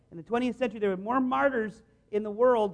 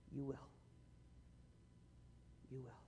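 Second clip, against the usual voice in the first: much lower and very soft, almost hushed with love; tender promise; 9 semitones lower, 29 dB quieter than usual. Acted. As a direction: breathe it gently as a loving promise. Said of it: "And he turns to them and says".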